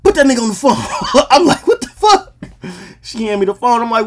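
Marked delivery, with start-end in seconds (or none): 0.0-0.8 mock yell. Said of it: "she like". 0.8-2.3 high laughing disbelief; cracking up in disbelief. Real, not acted. Laughing at the phone demand.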